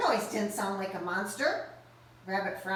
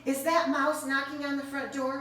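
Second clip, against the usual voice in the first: plain reading voice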